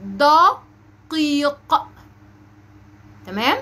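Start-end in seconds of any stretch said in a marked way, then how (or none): 0.0-1.6 slow "دقيق" with both q sounds heavy; raised, drawn-out model of "دقيق"; exaggerated qaf. Shown as a demonstration. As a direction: hold "دقيق" and pop the last q. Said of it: "هنقول".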